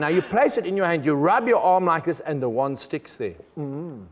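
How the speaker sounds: usual speaking voice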